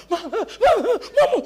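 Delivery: high, slow stuttered cries for ma; mock whimpering child clinging to a tree; hammed-up frightened bleating; terrified child.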